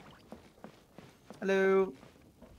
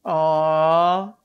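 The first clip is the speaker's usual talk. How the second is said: loud aww; 10 dB louder than usual. Real, not acted. Touched by the friend's food.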